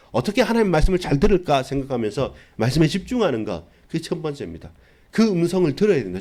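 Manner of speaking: full-force sermon climax; fiery preaching. Acted. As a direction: drive the call to zeal home to the congregation.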